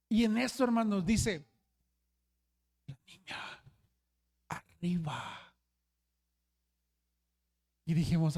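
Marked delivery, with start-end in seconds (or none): 2.9-5.5 toneless whisper, softer, "arriba" dragged very slowly; eerie whisper. Retold or imitated. Sleepwalker whispering a warning to the youth group. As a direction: hiss it out slowly like a ghost story.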